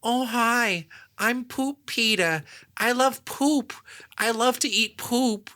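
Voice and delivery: high, slow whiny cartoon dad voice; high-pitched, whiny cartoon dad slowly telling everyone he loves poop; whiny Peter voice. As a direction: whine it like a silly cartoon dad, drawn out and high. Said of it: "It's, like, a whiny Peter".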